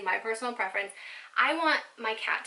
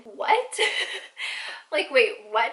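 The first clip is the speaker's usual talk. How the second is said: louder disbelieving what with a laugh; stunned what; 4 dB louder, slower than usual. Retold or imitated. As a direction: react in total shock. Stunned at being banned.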